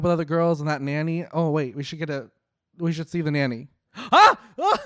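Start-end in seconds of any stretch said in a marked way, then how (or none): 4.1-4.8 sharp high gasp and yelp, much louder; startled gasping cry; shocked gasp. Real, not acted.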